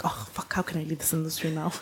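breathy whisper of mock panic; whispered dismay. Model whispers her dismay at not being able to quit, mock panic. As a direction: whisper it like a guilty aside to herself. Dismayed thought about quitting the industry.

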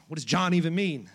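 ordinary delivery

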